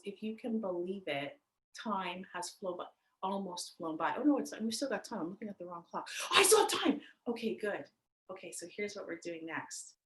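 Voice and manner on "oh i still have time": relieved surprise. Real, not acted. Realises she misread the clock.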